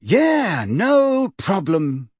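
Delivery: high, drawn-out false heartiness; horribly hearty. A narrator's reading. Boy lending his owl, horribly hearty. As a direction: stretch each word with overdone cheer. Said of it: "hearty voice that did not belong to him".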